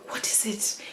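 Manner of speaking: hushed, whispered question; soft whisper. Retold or imitated. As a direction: imitate a dad asking in a whisper. Dad softly asking crying daughter.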